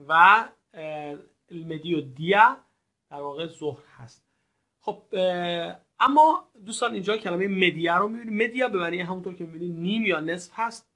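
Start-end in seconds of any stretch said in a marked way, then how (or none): none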